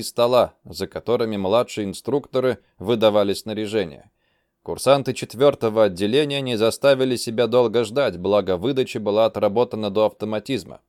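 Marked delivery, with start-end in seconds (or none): none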